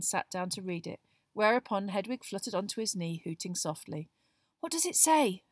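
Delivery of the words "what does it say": high, breathless question; breathless Hermione. A narrator's reading. Girl asking about the letter just arrived, breathless. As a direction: ask eagerly, short of breath. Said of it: "Hermione asked breathlessly".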